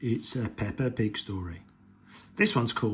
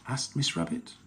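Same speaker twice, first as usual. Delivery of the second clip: plain reading voice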